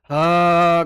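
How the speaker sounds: ह held long and loud with audible friction. Shown as a demonstration. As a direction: let the air rub through ह. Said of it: "घर्षण हो रहा है". (घर्षण के साथ लंबा ह)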